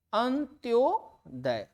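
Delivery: अंत्योदय said very slowly, each syllable separate; slow careful pronunciation. Shown as a demonstration. Teacher showing correct form, drawn out slowly. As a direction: stretch अंत्योदय out for the class. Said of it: "शुद्ध".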